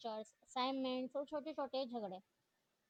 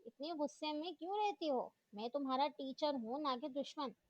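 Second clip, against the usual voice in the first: ordinary delivery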